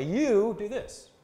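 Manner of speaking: "You" high, long and loud; stress on You. Shown as a demonstration. Showing how another language may mark emphasis, stress on You. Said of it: "they may say the same way".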